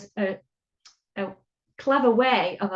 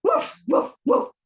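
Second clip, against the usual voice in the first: high woofs of a storybook dog; barking; 11 semitones higher, 4 dB louder than usual. A narrator's reading. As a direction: bark it out in short bursts. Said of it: "barks the dog".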